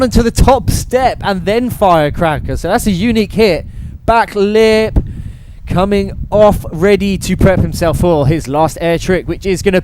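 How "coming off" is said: ordinary talk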